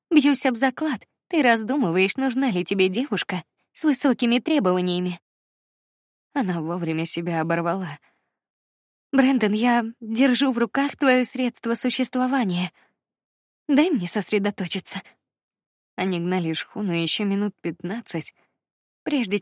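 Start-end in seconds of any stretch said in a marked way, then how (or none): 0.1-5.2 higher, louder playful tease; teasing. A narrator's reading. Heroine teasing banter. 9.1-15.0 higher, louder mock stern; half-serious telling off; playful scolding. A narrator's reading.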